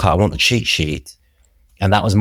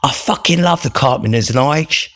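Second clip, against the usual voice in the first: put-on Cockney accent; 8 semitones higher than usual. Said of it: "in his Cockney Northern accent".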